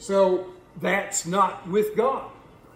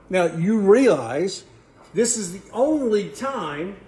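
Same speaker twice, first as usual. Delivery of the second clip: ordinary talk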